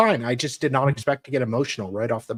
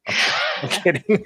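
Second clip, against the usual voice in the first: drawn-out tease; 6 semitones higher, much slower than usual. Acted. Joking after sarcastic jab.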